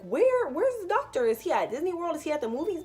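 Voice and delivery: high, loud, panicked protest; frantic disbelief. Retold or imitated. To her mom, insisting she must push now, pitched high and loud. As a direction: demand where the doctor is, half-panicked.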